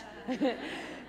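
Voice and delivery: breathy unvoiced laugh; a short airy laugh. Real, not acted. Laughs after the Nana quote.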